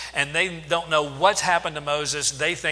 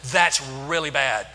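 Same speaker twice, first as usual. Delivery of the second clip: louder, firmly agreeing, yes yes; loud emphatic agreement; 7 dB louder than usual. Shown as a demonstration. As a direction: lead the crowd in a strong loud agreement. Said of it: "Yeah, say".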